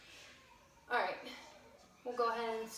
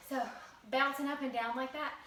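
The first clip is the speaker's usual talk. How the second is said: ordinary talk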